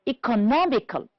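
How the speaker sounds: "Economical" stressed on NOM, then falling; stress on third syllable. Shown as a demonstration. Teacher correcting an exercise, third syllable of "Economical" stressed. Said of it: "stressed on the third syllable".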